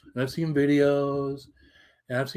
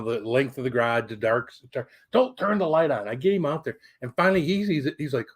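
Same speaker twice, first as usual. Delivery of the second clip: ordinary talk, nothing marked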